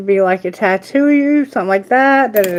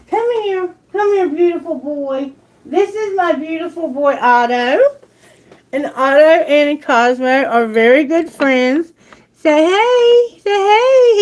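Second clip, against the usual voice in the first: cooing high at her pet; 4 semitones higher than usual. Real, not acted. Baby talk to her pet bird.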